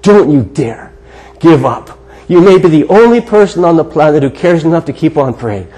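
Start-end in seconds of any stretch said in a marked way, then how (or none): 0.0-2.0 don't you DARE, pause, give up; low, deliberate emphasis broken by a pause; emphatic urging. Real, not acted. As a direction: urge it firmly, pausing before "give up". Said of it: "I would just emphasize this morning".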